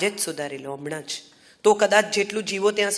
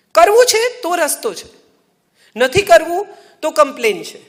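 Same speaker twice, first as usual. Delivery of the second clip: higher, louder declaration; loud, higher maxim to the audience; emphatic punchline; 6 semitones higher, 9 dB louder than usual. Real, not acted. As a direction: hammer home the moral. Punchline of the talk.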